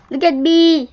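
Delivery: put-on high, loud young beetle; eager showing off. A narrator's reading. Young click beetle shows off after a tumble, eager. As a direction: call out proudly for everyone to watch.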